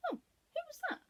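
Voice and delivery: very high startled question; lady surprised by a voice from the sack. Acted. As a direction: gasp it out in a high voice.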